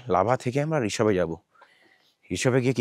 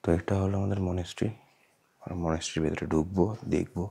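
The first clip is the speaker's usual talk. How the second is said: normal talking voice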